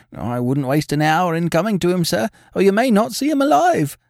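high, agitated landlady voice; distressed landlady. A narrator's reading. Landlady telling how she insisted on a doctor, agitated.

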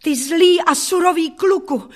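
high, loud accusation; girl hurling an angry insult; outraged cry. A narrator's reading. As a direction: cry out in indignation. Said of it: "vykřikla jsem".

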